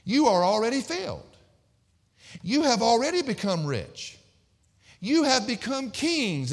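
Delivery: high, mock-grand proclamation; mocking congratulations, ringing out from the pulpit; sarcastic. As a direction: sarcastically hail them, drawing out each boast. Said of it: "this is very sarcastic".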